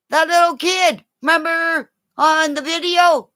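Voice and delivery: high, urgent calling for Linda; excited calling. Real, not acted. Calling to Linda across the room, high excited call. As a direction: call her over, all worked up.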